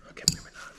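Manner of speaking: under-the-breath whisper. Real, not acted. Relieved whisper to himself as parts stay in. Muttering aside after parts nearly fall.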